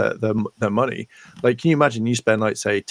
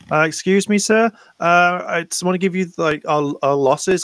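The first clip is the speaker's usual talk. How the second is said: high, hesitant underling voice; a timid staffer stammering out bad news; nervous employee; 7 semitones higher than usual. Employee reporting losses to the boss, hesitant and high. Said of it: "someone comes in and goes".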